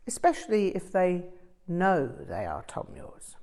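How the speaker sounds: plain reading voice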